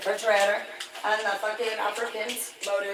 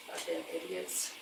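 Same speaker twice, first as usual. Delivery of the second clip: very low, soft whispered grumble; muttered aside; 14 semitones lower, 13 dB quieter than usual. Real, not acted. Grumbling about others to himself, soft whisper. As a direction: mutter it under your breath.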